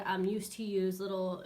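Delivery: ordinary delivery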